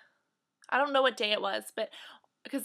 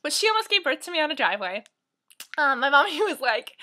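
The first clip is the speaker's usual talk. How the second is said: usual speaking voice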